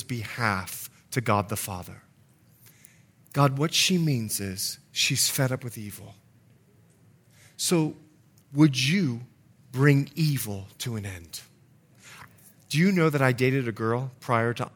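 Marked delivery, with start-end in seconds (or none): 3.3-11.4 soft, low, measured plea; gentle intercession. Acted. Jesus interceding to the Father for a child, hushed pleading. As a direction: pray it quietly, with pauses.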